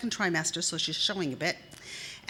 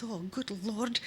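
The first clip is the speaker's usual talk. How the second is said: whispered sigh of exasperation; husband grumbling as he gets up; weary whisper; 6 dB quieter, slower than usual. Acted. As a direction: breathe it out, resigned. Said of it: "And he goes".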